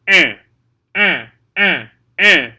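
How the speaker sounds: "An" pushed into the nose, loud; loud nasal "An" repeated. Shown as a demonstration. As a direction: put "An" in your nose and project it. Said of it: "put it in your nose".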